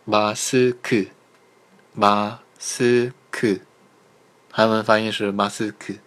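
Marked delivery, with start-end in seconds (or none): 0.0-3.6 马斯克 drawn out slowly, syllable by syllable; slow, separated ma, seu, keu in 马斯克; syllables joined slowly. Shown as a demonstration. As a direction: model 马斯克 very slowly for learners. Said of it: "连在一起".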